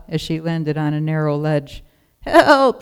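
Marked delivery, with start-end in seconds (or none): 2.2-2.8 high, loud wailing cry; lost lamb's wail for help. A narrator's reading. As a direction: wail it into the empty valley. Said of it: "she wailed".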